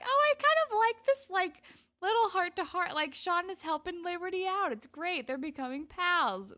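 high, loud mock gush; gushing delight. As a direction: recall your delight in a high-pitched voice. Gushing over a budding friendship.

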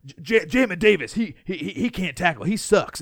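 lightly voiced coach blaming a player; mocking coaches. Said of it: "It's always".

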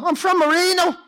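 high-pitched, incredulous retold cry; astonished exclamation. Comedian discovering a shared neighbourhood, retold amazed outburst. Acts out his astonished reply.

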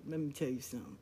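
soft, low and even opener; a quiet warning that needs no volume; calm warning. Retold or imitated. Mother calmly warning her sisters, low and soft. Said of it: "She was very calm when she went at her sisters".

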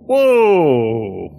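loud, drawn-out exclamation; guard startled by the party's blunt answer; surprised whoa. Acted. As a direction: react in shock at the honesty.

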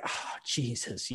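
breathy, slow, exasperated "Oh, Jesus"; exasperated groan. Retold or imitated. Son hearing the plane is delayed, muttered exasperation. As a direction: sigh it out as the flight is delayed. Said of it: "He's like".

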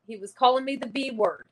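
ordinary delivery

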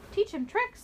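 put-on high, eager kids calling; children's voice. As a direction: ask eagerly like small children. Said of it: "said the children".

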